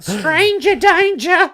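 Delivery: very high, drawn-out squeal; mock alarm. Joking about running up to kids, high drawn-out mock alarm. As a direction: squeal it slowly like a panicking child. Acts out kid's alarm at stranger.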